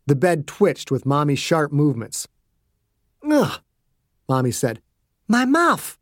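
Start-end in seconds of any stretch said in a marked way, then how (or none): 3.2-3.6 disgusted groan. A narrator's reading. Mommy disgusted. 5.3-6.0 higher pained woman's voice; mother in distress. A narrator's reading. Mommy in pain.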